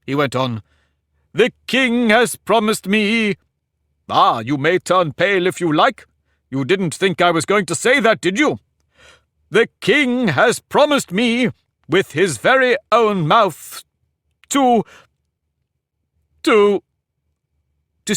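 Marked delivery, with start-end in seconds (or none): 1.3-3.4 high, slow, pompous, stress on has; grand announcement. Acted. Humpty announcing the king's promise. 4.1-8.6 put-on pompous egg lecturing a girl; haughty egg. Humpty gloating at Alice. 9.5-18.1 higher, slow, stress on promised, halting; grander announcement. Acted. Humpty repeats, more grandly.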